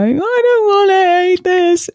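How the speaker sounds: put-on high, slow whining little girl; whiny toddler. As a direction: mimic a two-year-old refusing dinner. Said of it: "she is going to sit down at dinner and go".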